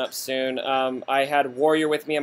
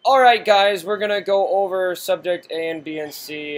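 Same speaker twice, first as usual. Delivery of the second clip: raised, louder video-intro voice; mobile gamer; 4 semitones higher, 4 dB louder than usual. Retold or imitated. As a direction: launch straight into the rundown. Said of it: "my mobile gamer impersonation".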